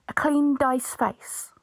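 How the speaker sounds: slow, careful retry; slow deliberate correction. Real, not acted. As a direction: say it slowly and carefully. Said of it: "I can talk today, honest".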